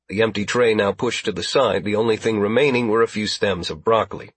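plain reading voice

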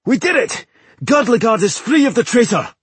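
high, loud victory cheer; exultant cry. A narrator's reading. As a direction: shout the triumph in a high voice. Dwarf celebrates a victory in battle.

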